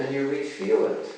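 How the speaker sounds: usual speaking voice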